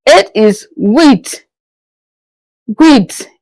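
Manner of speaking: slowly, eight said as wheat; French huit. Shown as a demonstration. Teacher drilling huit to the class. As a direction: model huit, spelt wheat, carefully. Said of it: "A is what?".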